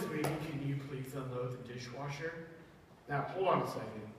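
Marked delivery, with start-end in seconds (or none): 0.0-2.3 soft, low sweet mother voice; sweet mom. Acted. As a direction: ask it gently like a kind mom. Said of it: "your mom says".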